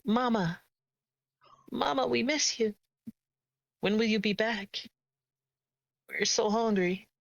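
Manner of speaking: put-on high, small child's voice calling for mama; children calling to their absent mother, high and pleading. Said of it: "you hear the words".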